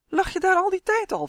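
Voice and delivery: high, loud astonished question; stunned to find him right there; boy surprised that the rock is his friend; amazed. A narrator's reading. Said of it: "vroeg Justin verbaasd".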